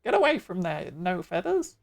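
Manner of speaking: swooping, squawky bird-creature voice; squawking bird creature. Acted. Bird creature guarding balloon wreckage, squawking. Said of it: "bird noises".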